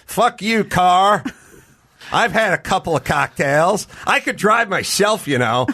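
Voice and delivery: hammed-up drunk ranting at his car; belligerent drunk. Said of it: "I'd start getting belligerent".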